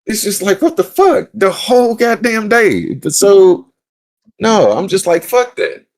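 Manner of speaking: high-pitched, incredulous venting; exasperated outburst. Real, not acted. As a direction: spike the pitch in disbelief at the whole day. Venting about his awful day.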